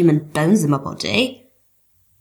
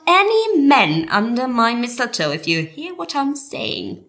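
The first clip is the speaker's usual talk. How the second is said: campy innuendo; 6 semitones higher than usual. Acted. Camp coming-out innuendo.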